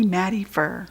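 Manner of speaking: usual speaking voice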